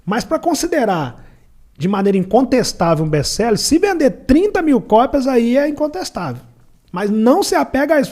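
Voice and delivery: high, loud lecturing friend; voicing Anderson. Anderson warning him not to count on best-seller status, high and loud. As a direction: lay down the rule like a seasoned publisher. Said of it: "Ele falou".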